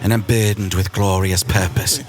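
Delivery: a lightly voiced Loki; Loki line. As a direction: intone it like a god-prince. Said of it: "they'll just go around going".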